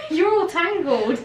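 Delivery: high, loud, slow laughing words. Real, not acted. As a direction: giggle while teasing. Laughs at tangled strap.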